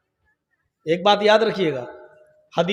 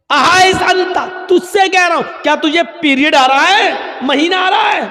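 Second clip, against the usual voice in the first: higher, louder impatient yelling; exasperated shout; 7 semitones higher, 4 dB louder than usual. Retold or imitated. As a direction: yell the question at him.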